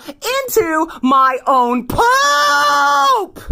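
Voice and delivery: POOP held long and high; exasperated wail. Real, not acted. Telling viewers about a bathroom disaster, exasperated wail. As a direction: wail it out, drawing out the last word. Exasperated climax of gross story.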